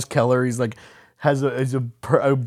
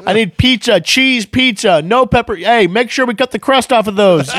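loud, higher frantic orders; mock chef yell; 5 semitones higher, 5 dB louder than usual. Chef barking at the kitchen, loud mock yell. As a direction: bark orders across the kitchen.

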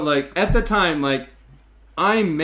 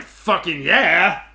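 drawn-out excited yell; 3 semitones higher, 6 dB louder, much slower than usual. Retold or imitated. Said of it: "I'm like".

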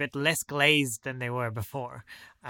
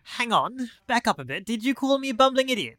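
offended; 9 semitones higher, 4 dB louder than usual. Acted. Offended character demands repeat.

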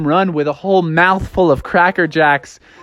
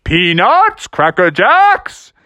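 higher, drawn-out hawking cry; more confident peanut seller's call; projected vendor call; 4 semitones higher, much slower than usual. Acted. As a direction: project it to the stands, stretching the end. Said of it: "started to really project his voice".

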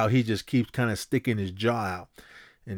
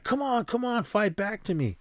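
high-pitched mock taunt; goading boxer; 12 semitones higher than usual. Drago daring Rocky to fight back, high mock goading. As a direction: imitate a fighter egging on his opponent, pitched high.

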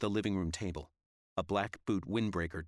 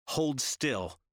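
commanding shout; 6 semitones higher, 4 dB louder than usual. Acted. Commanding at gunpoint.

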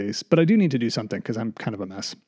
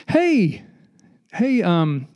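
talking to dog; 5 semitones higher, much slower than usual. Retold or imitated. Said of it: "you're walking your dog".

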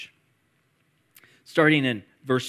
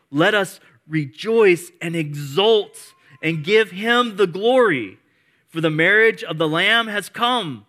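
loud, higher, ringing proclamation; preaching praise; 4 semitones higher, 6 dB louder, slower than usual. Acted. Pastor voicing the multitude's praise, loud proclamation. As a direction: declare it with full force.

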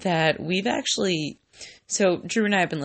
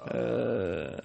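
low monotone; 7 semitones lower, 8 dB quieter than usual. Pretend. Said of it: "How low monotone do you think my voice can go".

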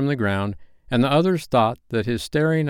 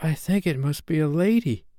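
put-on high, mildly scared boy; 7 semitones higher than usual. A narrator's reading. Slightly realising it is a lady, mildly scared. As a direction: say it high with a touch of fear. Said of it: "he said in a scared voice".

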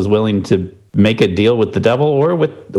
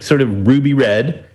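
ordinary talk, nothing marked

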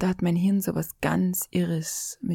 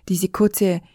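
normal talking voice

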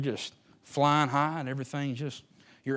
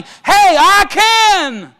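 much higher, louder shout; God shouting; 20 dB louder, much slower than usual. Retold or imitated. Preacher voicing God inside a doubter, triumphant shout. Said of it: "there's a God inside of you that is shouting".

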